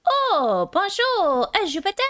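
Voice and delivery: high, hammed-up French accent; a silly singsong French hello; mock French.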